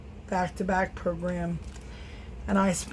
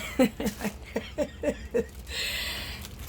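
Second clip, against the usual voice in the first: breathy run of giggles; real amused laughter tailing off; 4 semitones higher, 3 dB quieter than usual. Real, not acted. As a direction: laugh at your own impression. Laughing at her own imitation.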